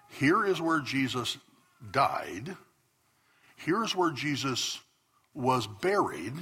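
slow, deliberate tour-guide statements; statement of fact. Retold or imitated. Guides telling tourists where Jesus died, slow and matter-of-fact. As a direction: lay it down as plain fact, slowly. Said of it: "They don't say it as a question".

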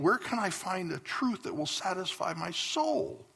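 lightly voiced pleading girl, rising high at the end; a pastor imitating a praying teen girl's desperate plea, mildly pleading. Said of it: "I hear her saying".

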